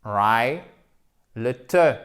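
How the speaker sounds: "Rai" set apart and punched, then "te"; teacher breaking the word into "Rai" and "te"; stressed first syllable. Shown as a demonstration. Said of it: "par l'accent tonique".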